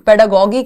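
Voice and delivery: पेडागोगी with a hard g in the last syllable; hard G variant. Shown as a demonstration. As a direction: say पेडागोगी ending in "gee" as in geese. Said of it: "कह देते हैं कई लोग".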